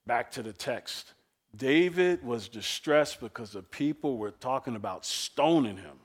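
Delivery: usual speaking voice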